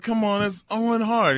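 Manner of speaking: lightly put-on voice calling him out; hammed-up fake voice. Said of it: "Making these fake voices and stuff".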